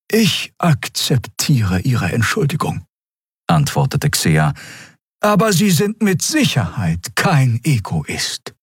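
slow, high, measured elder; dignified old Xea. Acted. Elder accepting a man's apology, dignified. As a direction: speak slowly and high with dignity.

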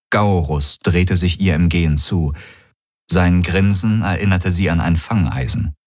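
ordinary delivery, nothing marked

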